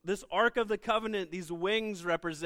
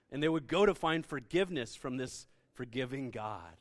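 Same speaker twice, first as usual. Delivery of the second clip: ordinary delivery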